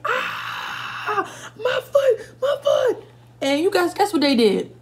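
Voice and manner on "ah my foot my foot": high, half-laughing cry of my foot; retold pained scream. Telling viewers how she fell down the steps, a laughing mock cry of pain. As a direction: replay the fall, crying out in pain through laughter. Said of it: "I'm just screaming like".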